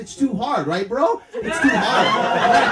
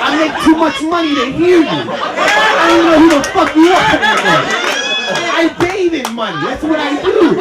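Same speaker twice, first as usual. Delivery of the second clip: loud, higher mock-rich bluster; arrogant dismissal; 4 semitones higher, 8 dB louder than usual. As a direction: shout them away with hammed-up arrogance. Acts arrogant rich man dismissing people.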